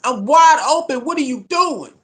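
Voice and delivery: high, loud cries of an open receiver ignored; frustrated receivers yelling. Retold or imitated. Receivers yelling at their quarterback, frustrated and loud. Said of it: "They're like".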